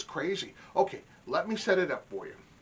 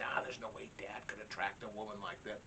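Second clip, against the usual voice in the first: put-on dismissive kids; 7 semitones lower, 12 dB quieter than usual. Children's dismissive voice quoted.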